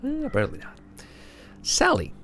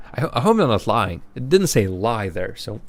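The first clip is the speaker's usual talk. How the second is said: normal talking voice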